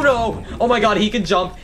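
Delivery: normal talking voice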